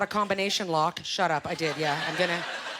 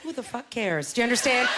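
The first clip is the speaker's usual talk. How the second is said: usual speaking voice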